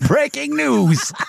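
high, slow, hammed-up breaking news; news announcer. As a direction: announce it like a TV anchor. Mock announcement of alphorn video.

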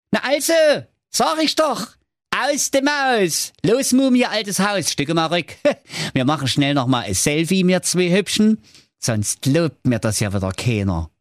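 high, boastful show-off prince; cocky prince voice. Acted. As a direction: brag like a vain young prince. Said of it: "der Prinz rief". (angeberischer Prinz)

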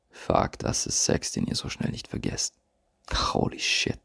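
breathless hushed swear; awed whisper. Real, not acted. Coach recalling unforgettable sex, whispering in awe. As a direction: whisper it like you can't believe it.

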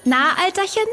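put-on high, bright child-angel voice; lightly voiced cheerful child; Christkind voice. A narrator's reading. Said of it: "fragte das Christkind". (kindliche Christkindstimme)

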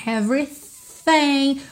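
"Everything" with a breathy, unvoiced TH, just air. Shown as a demonstration. Teacher contrasting the unvoiced TH with the voiced one. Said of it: "O T H aqui não tem vocalização. Só arzinho".

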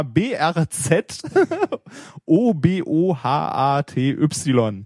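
slow letter-by-letter spelling. Shown as a demonstration. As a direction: spell each letter out slowly. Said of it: "Ich buchstabiere das mal".

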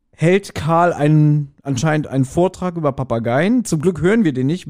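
normal talking voice

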